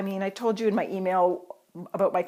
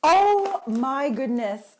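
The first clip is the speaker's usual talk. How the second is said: slow, drawn-out "oh my gosh", higher; awed gasp; 5 semitones higher, 7 dB louder, much slower than usual. Real, not acted. Vlogger finding glitter in the gift package, slow and awed. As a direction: let it stretch out in delighted amazement.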